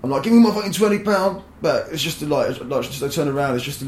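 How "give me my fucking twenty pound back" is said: high, loud angry demand; drunken shout. Retold or imitated. Drunk man shouting at the woman who took his twenty, high and loud. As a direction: yell it at the thief, fists full.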